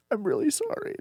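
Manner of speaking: high, soft imitated apology; small high voice of a weepy woman; mock tearful. Weeping lady apologizing on her way out, soft mock tearful. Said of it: "the lady that was loudly weeping".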